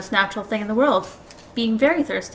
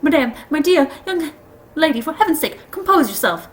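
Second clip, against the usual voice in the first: high, agitated pleading; trembling scream; 7 semitones higher, 5 dB louder than usual. A narrator's reading. Uncle Andrew begging the witch to compose herself, trembling scream. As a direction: plead in a raised, panicky voice. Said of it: "in a sort of trembling scream".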